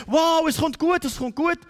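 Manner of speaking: high, loud burst of hope; elated realisation. Acted. Believer realising it will turn out well, elated. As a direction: exclaim it with sudden joyful confidence.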